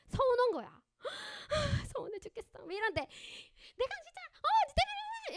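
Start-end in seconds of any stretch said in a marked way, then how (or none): 1.0-1.9 hurt gasp. Shown as a demonstration. 1.9-5.3 high, whiny hurt voice rising to a loud cry; sulk, then burst out blaming; wounded, accusing wail; hurt and angry. Shown as a demonstration. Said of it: "이렇게 하면서 서운해서 화나는".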